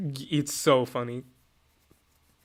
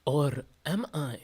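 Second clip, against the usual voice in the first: put-on slow, softer French-accented question; mock-French Alpharius, drawn out and softer; 5 dB quieter, slower than usual. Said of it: "my French isn't that good".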